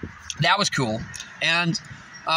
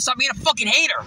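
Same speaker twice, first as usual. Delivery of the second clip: mildly raised, higher yell; retold yell; 4 semitones higher than usual. Yelling at a hater in traffic, retold yell. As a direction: call it out with a higher voice. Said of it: "and I yelled".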